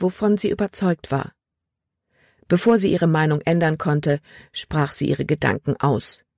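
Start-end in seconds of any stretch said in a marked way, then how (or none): none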